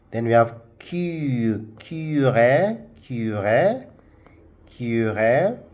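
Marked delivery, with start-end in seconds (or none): 1.8-5.6 "cure" as French curé, kyu-RAY, rising at the end; two syllables in "cure", lifting on the final "ré"; pronounced accented E. Shown as a demonstration. Teacher modelling the word for learners, "cure" said kyu-ré with rising end. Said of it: "the E at the end of the word is pronounced".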